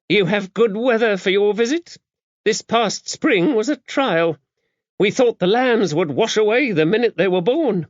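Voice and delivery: high-voiced, brisk, no-nonsense abbess; a worldly, gruff nun laying down the rules; gruff old abbess. A narrator's reading. Dame Tabitha briefing the visiting priest, gruff and brisk.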